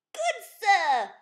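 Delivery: a keen little knight announcing himself; bright, bold young knight voice; eager young knight. Acted. Kevin speaks, high and loud.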